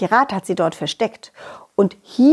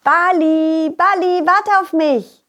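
higher calling after ball; 7 semitones higher, slower than usual. Acted. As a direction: call after him.

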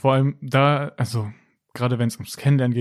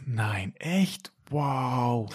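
soft amazement; 4 dB quieter, much slower than usual. Pretend. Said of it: "dass man dieses".

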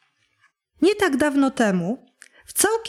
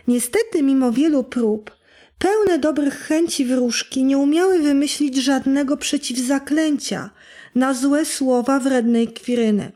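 plain reading voice

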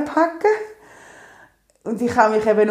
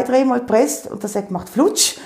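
ordinary talk

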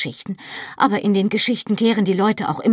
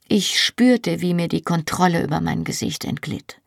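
usual speaking voice

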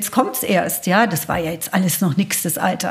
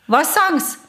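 policeman; 7 semitones higher than usual. Retold or imitated. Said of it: "und der Polizist so".

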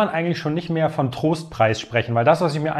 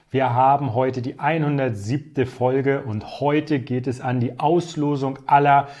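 normal talking voice

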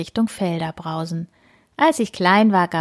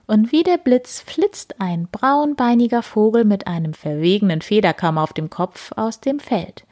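ordinary delivery, nothing marked

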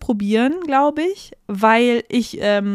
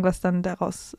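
normal talking voice

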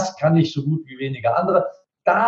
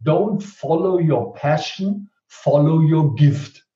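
quoted motto; much slower than usual. Shown as a demonstration. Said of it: "Der Spruch heißt".

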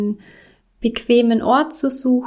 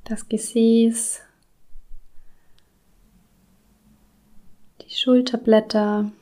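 whispered. Acted. Body scan cues whispered.

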